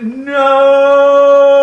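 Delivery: high, long-held loud cry; warning shout. Retold or imitated. Boy at the window warning the dog, long high shout. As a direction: yell one drawn-out warning from the window. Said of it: "I tried to warn that cat".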